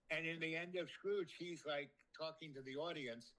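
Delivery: ordinary talk, nothing marked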